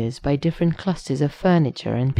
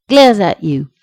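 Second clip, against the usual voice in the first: much louder, higher mock-angry burst; exclaimed; 4 semitones higher, 10 dB louder than usual. A narrator's reading. Shallot rebukes Sheske in a message, loud exclamation. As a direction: punch it out as an indignant exclamation. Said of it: "Exclamation mark, exclamation mark".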